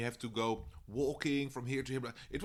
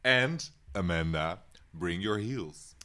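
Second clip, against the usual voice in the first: quoting Tyra; 7 dB louder, much slower than usual. Retold or imitated. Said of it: "she says something very specifically".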